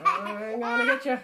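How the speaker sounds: high, loud, laughing I'm gonna get ya; playful threat. Real, not acted. Mother playfully chasing her little son, loud and laughing.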